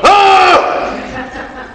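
high strained shouts of lifting; imitated straining yells. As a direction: yell like you're lifting to failure. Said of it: "And I was one of them".

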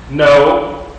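ordinary talk, nothing marked

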